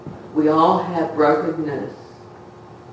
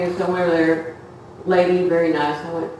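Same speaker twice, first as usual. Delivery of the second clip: ordinary talk, nothing marked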